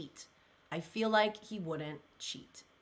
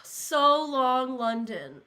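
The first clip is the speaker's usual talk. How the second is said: sighing title; 3 semitones higher, 5 dB louder, much slower than usual. Real, not acted.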